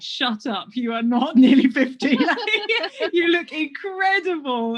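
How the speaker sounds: retold disbelief, growing loud with laughter; amused disbelief. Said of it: "that I couldn't believe".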